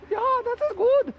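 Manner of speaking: very high-pitched German accent. Shown as a demonstration. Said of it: "German accent".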